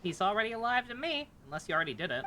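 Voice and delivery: ordinary talk, nothing marked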